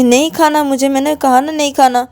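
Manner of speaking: stubborn angry refusal. Acted. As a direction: refuse flatly, fed up.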